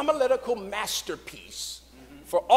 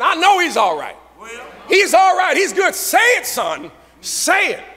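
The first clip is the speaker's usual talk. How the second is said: church elder shouting; 8 semitones higher than usual. Retold or imitated. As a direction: shout him on from the third row. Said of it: "there she was just shouting".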